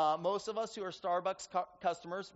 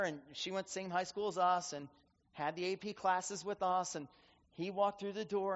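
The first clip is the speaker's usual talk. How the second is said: ordinary delivery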